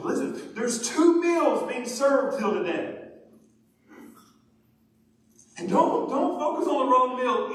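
loud, slowed, exhorting preacher; full-force preaching. Real, not acted. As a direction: urge the congregation with weighty, stretched words.

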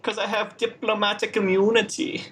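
hint of a smug South African diplomat; mock South African villain. Quoting the Lethal Weapon 2 villain in a mock South African accent. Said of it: "Or the Lethal Weapon two".

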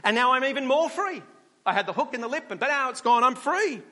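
the fish celebrating; excited fish. Acted. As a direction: fish crowing about freedom. Fish exulting.

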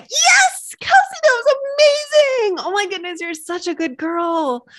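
excited squeal of praise to herself; puppy-style self-praise. Pretend. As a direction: praise yourself like you'd praise a puppy. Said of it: "What if we were like".

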